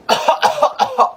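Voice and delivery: high, hammed-up coughing; put-on coughing fit; fake cough. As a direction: cough as if faking sick. Child feigning illness to skip school.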